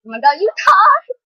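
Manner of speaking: high, loud fangirl squeal; excited squeal. Real, not acted. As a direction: squeal at the screen in delight. Fan reacting to idol speaking.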